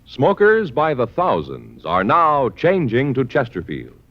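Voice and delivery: ordinary talk, nothing marked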